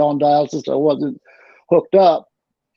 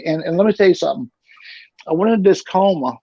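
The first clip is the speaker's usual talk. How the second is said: normal talking voice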